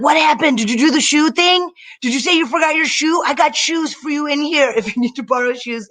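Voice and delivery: pepper him with excited questions; mock-excited quiz of a friend; eager mock questions.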